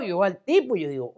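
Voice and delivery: ordinary talk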